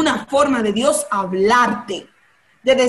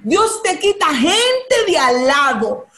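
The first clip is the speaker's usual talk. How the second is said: ordinary talk, nothing marked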